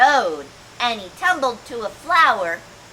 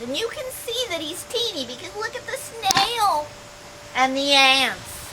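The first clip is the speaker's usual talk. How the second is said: plain reading voice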